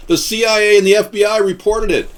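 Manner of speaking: loud, high-pitched protest; emphatic insistence. Real, not acted. Insisting on his claim.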